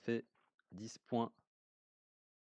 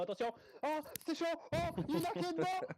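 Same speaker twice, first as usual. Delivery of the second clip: excited. Real, not acted. Live esports commentary hype.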